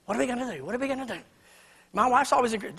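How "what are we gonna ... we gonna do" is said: soft, hushed fretting, much quieter; worried fretting. Retold or imitated. Worry wart husband fretting to his wife, quiet mock panic. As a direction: mutter the worry over and over under your breath. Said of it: "I'm just a worry wart in the family".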